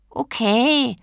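high, loud, drawn-out okay; grudging. A narrator's reading. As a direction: give in with exasperation. Genie grudgingly gives in. (genervt nachgebend)

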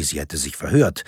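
ordinary delivery, nothing marked

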